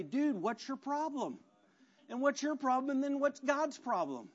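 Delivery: high, indignant griping at the leader; exasperated complaint. Retold or imitated. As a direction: demand answers, fed up. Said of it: "They look at the leader and say".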